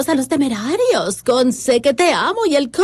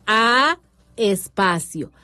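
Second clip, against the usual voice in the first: slow spelling dictation; 2 semitones lower than usual. Shown as a demonstration. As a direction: dictate each piece slowly, pausing on every espacio. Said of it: "Explícalo". (dictado lento, marcando cada espacio)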